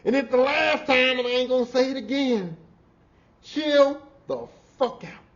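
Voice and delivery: loud, high, drawn-out yell of a warning; mock shouting warning. To a coworker, a loud drawn-out warning. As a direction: bellow it slow like a last warning.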